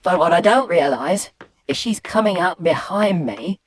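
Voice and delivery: ordinary talk, nothing marked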